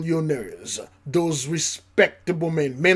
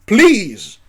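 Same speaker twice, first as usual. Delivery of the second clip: pleading elite; 8 semitones higher, 10 dB louder than usual. Pretend. Groveling billionaire begging the preacher's people, loud. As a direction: beg like a desperate rich man.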